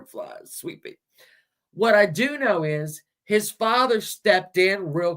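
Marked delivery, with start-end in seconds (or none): 0.0-1.0 soft whisper to a sick pet. Real, not acted. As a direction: whisper tenderly to a poorly pet. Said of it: "oh, I hope you feel better".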